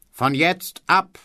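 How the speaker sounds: high, slow, drawn-out orator; quoting a historic speech. Retold or imitated. Mocking tutor quoting a famous speech slowly and grandly. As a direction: declaim each word like a rally speech. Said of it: "großen Sätzen der Geschichte".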